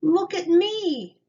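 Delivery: high, loud, slow call across the park; proud call, drawn out. A narrator's reading. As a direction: call friends over to watch. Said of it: "he called".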